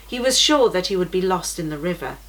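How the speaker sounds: ordinary delivery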